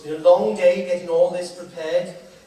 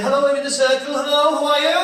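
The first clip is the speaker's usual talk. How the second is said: high, louder call up to the circle; bright call to the upper seats; 7 semitones higher, 4 dB louder, faster than usual. Real, not acted. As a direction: hail the people in the balcony. Calling up to the circle.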